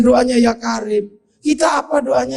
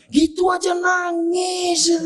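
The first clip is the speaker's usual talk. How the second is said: high, slow mock weeping; mock crying; 9 semitones higher, 5 dB quieter, slower than usual. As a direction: whimper it like a half-joking sob. Teller mocks the crying.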